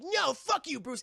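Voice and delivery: sharp high shout at Bruce; angry yell. Retold or imitated. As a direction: spit it out like a furious sidekick. Robin yelling at Batman.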